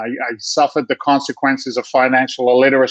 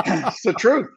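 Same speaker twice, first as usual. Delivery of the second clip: laughing; 9 semitones higher, slower than usual. Real, not acted. Laughing about wife's credit cards.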